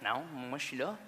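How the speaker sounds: low, soft, crestfallen answer; deflated reply. Acted. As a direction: say it small and low, passed over. Comic answering deflated to a fan.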